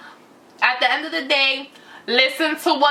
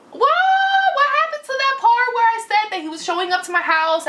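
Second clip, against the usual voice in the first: incredulous outburst; 11 semitones higher than usual. Real, not acted. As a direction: burst out in disbelief at the lie.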